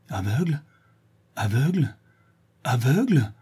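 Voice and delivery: stunned questioning, growing with each word; devastated disbelief. Acted. Brigand realising he is blind, devastated. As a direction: repeat it as if crushed. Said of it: "répéta le brigand anéanti".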